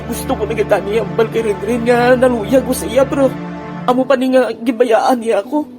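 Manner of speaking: ordinary talk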